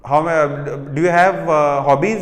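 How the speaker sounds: ordinary talk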